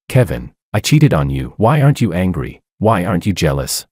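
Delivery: plain reading voice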